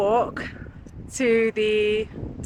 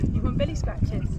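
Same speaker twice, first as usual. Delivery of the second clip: high, breathy pet talk. Real, not acted. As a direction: coo at the dog. Talking to a dog.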